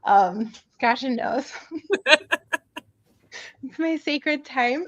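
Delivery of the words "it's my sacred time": laughing. Real, not acted. Laughing about breakfast ritual.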